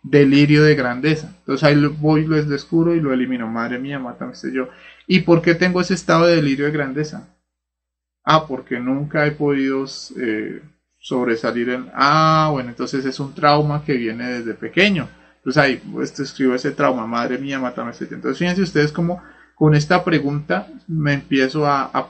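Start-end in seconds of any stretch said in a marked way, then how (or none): 3.5-4.7 a plea dropping to a murmur; soft inner invocation to the Mother; hushed prayer. Shown as a demonstration. Teacher demonstrates inner prayer formula. 17.1-18.2 a plea dropping to a murmur; soft inner invocation to the Mother; hushed prayer. Shown as a demonstration. Teacher demonstrates inner prayer formula.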